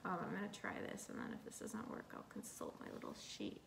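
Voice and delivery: quiet whispered aside; muttered aside. Real, not acted. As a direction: mumble it under your breath. Mumbling to herself.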